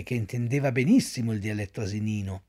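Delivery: ordinary delivery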